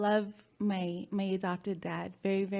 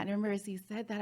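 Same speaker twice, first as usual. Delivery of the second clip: normal talking voice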